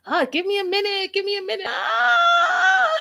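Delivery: high frantic pleading building to a loud shriek; hammed-up panicked stalling, ending in a scream; screaming in mock terror. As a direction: imitate someone too scared to jump. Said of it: "What are you screaming about".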